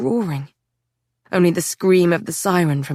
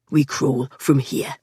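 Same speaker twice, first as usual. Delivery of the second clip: low man voice; 5 semitones lower, slower than usual. A narrator's reading. Patrick in the tunnel.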